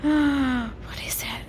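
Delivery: slow hushed whisper; spooky whisper. A narrator's reading. Reading to a child, slow suspenseful whisper. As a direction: whisper it like something lurks above. Suspense, creak in branches above.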